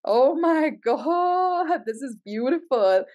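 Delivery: high, drawn-out slow awe; moved gasp. Real, not acted. Reader moved by the reading, slow high gasp. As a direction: let it melt out, very slow and high. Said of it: "I'm getting so emotional".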